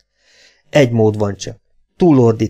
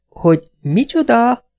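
high, drawn-out incredulous question; slow, high disbelief; indignant mother; 11 semitones higher than usual. A narrator's reading. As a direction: voice an affronted mother, pitched high. Mother's shocked question, voiced in story.

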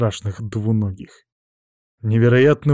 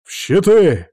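high, loud battle order; shouted command; 8 semitones higher, 6 dB louder, slower than usual. A narrator's reading. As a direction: bark the order to shields. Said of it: "раздался приказ командира".

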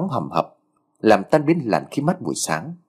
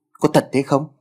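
startled; 4 semitones higher than usual. Acted. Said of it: "Giọng cậu ta nghe thảng thốt".

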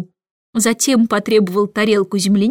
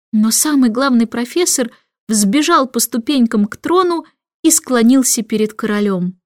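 usual speaking voice

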